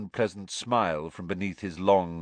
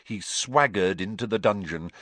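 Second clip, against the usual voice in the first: ordinary delivery, nothing marked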